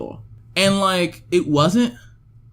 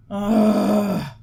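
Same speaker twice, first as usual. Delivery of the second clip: exasperated groan; 4 semitones higher than usual. Real, not acted. Exasperated at the film's changes.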